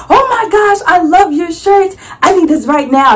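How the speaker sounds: high, loud, gushing; excited fan. Retold or imitated. Young woman gushing over the shirts. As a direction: gush like a thrilled shopper.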